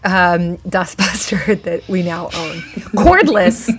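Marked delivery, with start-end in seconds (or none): 3.0-3.7 high, loud, proud reveal; proud announcement. Acted. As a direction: announce it with pride.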